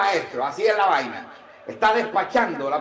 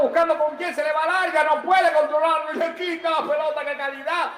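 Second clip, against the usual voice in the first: building, higher commentary at full tilt; live play-by-play; 4 semitones higher than usual. Real, not acted. Commentator following Mbappé's run, excited play-by-play. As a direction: ride the attack, climbing in pitch.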